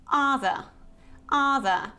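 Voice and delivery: "other" with the vowel of cut, "UH-thuh"; ah sound. Shown as a demonstration. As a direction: model "other" with no final R. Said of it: "like cut".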